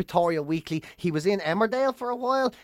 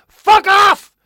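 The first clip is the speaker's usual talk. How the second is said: furious roar, much higher and louder; 10 semitones higher, 8 dB louder than usual. Retold or imitated. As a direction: roar them off your doorstep. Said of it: "roaring out the little window".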